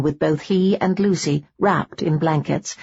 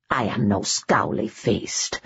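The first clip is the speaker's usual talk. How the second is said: lower, slower gruff Highland protest; Scottish protest; 9 semitones lower, 4 dB quieter, slower than usual. Acted. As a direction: protest in a slow, low brogue. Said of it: "Tillich protested".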